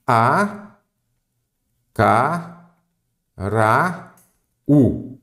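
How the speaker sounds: "A, ca, ra, u" split into syllables with long pauses; sílaba por sílaba. Shown as a demonstration. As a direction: say "A, ca, ra, u" one separate syllable at a time. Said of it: "separar em sílaba". ("A, ca, ra, u" separado em sílabas, com pausas)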